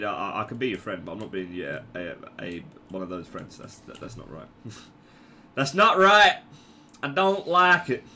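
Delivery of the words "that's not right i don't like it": loud, higher, badly faked American accent. Gamer objecting to himself, in a bad American accent. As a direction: push out a hammy American accent. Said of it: "I've gone a very shit American accent".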